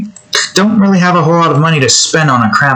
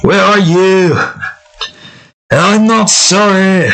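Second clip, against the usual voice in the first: Shrek voice; 6 semitones higher, slower than usual. Shown as a demonstration. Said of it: "I can't do his voice".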